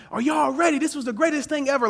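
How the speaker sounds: high-pitched imitation of thrilled older ladies; mock excited yelling. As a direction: squeal it like hyped-up ladies, without real volume. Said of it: "they're, like, yelling, screaming".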